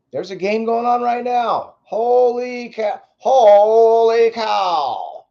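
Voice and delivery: put-on old-time radio baseball call, high and loud; 1950s announcer. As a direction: belt it like a fifties ballpark announcer. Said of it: "Old, old guy, nineteen fifties announcer".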